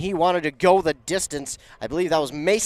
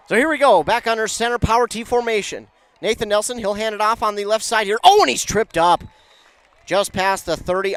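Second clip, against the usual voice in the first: higher, louder excited call; live play-by-play; 5 semitones higher, 6 dB louder than usual. Acted. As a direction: call the run as it happens.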